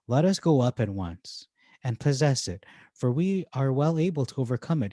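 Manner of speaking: ordinary talk, nothing marked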